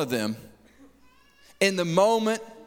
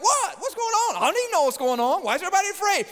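much higher, bewildered what; puzzled surprise; 12 semitones higher, much faster than usual. Pretend. Jesus waking in the storm, mock puzzled surprise. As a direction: play the startled sleeper. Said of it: "And Jesus is like".

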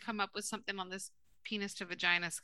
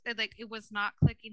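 usual speaking voice

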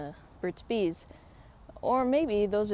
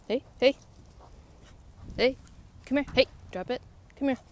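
calling the dog; 6 semitones higher, faster than usual. Real, not acted. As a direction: get her attention. Dog chewing a stick.

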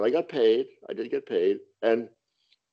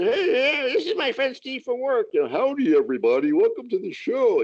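higher hammed-up host voice; mock emcee greeting; 10 semitones higher than usual. Comic introducing himself to arriving guests, higher mock host voice. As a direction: play the cheesy greeter.

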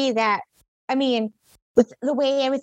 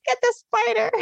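much higher mock-panicked squeal; panicked plea; 12 semitones higher than usual. Begging him to deal with the spider, high retold panic. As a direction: beg him frantically, pitched way up. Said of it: "I'm freaking out".

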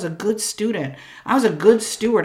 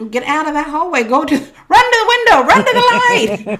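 much higher and louder, building to a cry; rallying shout; 9 semitones higher, 9 dB louder than usual. Real, not acted. Calling listeners to run to the light, excited shout. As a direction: urge them out, voice rising to a joyful yell.

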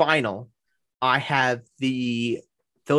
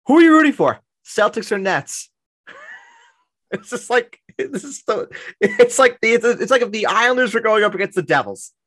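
excited high teasing; 11 semitones higher, 5 dB louder than usual. Real, not acted. Teasing a Nets-fan co-host.

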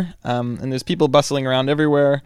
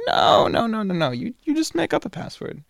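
higher, insistent Italian woman's voice; Italian woman insisting; 10 semitones higher than usual. Retold or imitated. Intercom woman waving off his apology, higher and insistent. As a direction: brush off his worry in her voice, much higher. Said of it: "female Italian voice".

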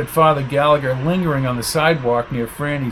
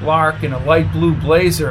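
ordinary delivery

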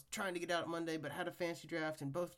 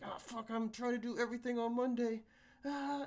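stressed exclamation; 7 semitones higher, 3 dB louder than usual. Retold or imitated. Quoting own stressed self.

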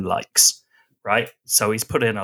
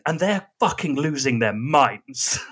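ordinary delivery

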